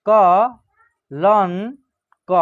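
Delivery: कलंक very slowly, syllable by syllable, with a rising end; slow dictation of कलंक; broken into syllables. Shown as a demonstration. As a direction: drag कलंक out so the nasal is heard. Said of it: "तोड़ के पढ़िएगा".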